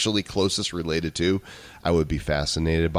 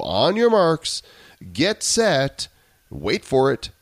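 hyped announcer countdown; 6 semitones higher, 3 dB louder, slower than usual. Acted. Hyping the show start.

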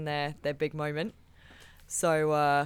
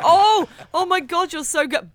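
much higher, much louder, slow hammed-up gushing; mock fake flattery; 12 semitones higher, 10 dB louder than usual. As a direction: gush falsely before the slam. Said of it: "where it's like".

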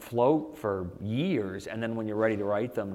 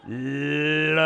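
musical; 5 semitones higher, 4 dB louder, much slower than usual. Retold or imitated. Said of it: "I started going".

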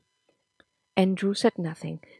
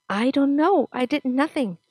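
stammering; 6 semitones higher, 3 dB louder than usual. Acted. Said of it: "he stammered".

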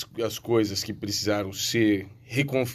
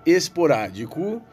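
announcer; 5 semitones higher than usual. Acted. Host opens the show.

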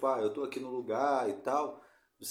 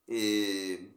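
ordinary talk, nothing marked

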